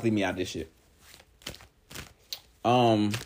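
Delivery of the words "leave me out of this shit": much lower, quiet flat dismissal; a woman waving off the drama, low and quiet. Retold or imitated. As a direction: drop your voice low and wave it off. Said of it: "Her cards".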